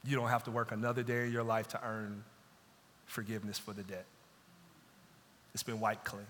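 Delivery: soft, low, tender release; merciful king, softly forgiving the servant's debt; gentle pardon. Retold or imitated. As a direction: let him off the hook gently. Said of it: "So the king says".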